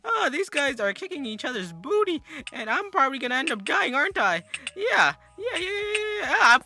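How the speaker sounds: high, swooping gamer commentary; hammed-up YouTuber excitement; Adventure Gaming HQ impression. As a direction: exaggerate every word with voice leaping up. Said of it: "we're gonna impersonate him".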